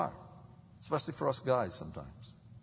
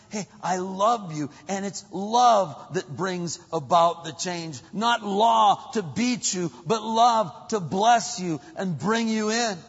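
loud, raised, emphatic proclamation; full-force preaching; 8 semitones higher, 10 dB louder than usual. Real, not acted.

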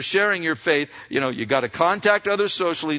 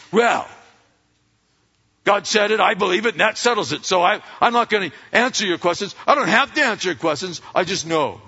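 mock self-righteous, raised pitch, faster; uppity; 4 semitones higher, 4 dB louder than usual. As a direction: play a smug know-it-all. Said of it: "all uppity and say".

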